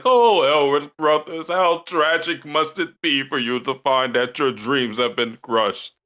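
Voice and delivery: high theatrical lament; hammed-up tragic oration, loud at the start; melodramatic sympathy. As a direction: proclaim the sorrow grandly. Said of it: "He was like".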